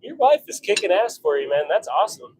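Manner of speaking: usual speaking voice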